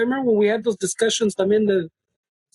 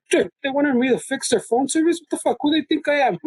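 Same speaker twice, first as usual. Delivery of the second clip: higher-pitched mock indignation; indignant; 6 semitones higher than usual. Coworker griping about fixing phone service, indignant. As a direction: imitate an affronted coworker, pitched up. Quotes dudes indignant at being asked.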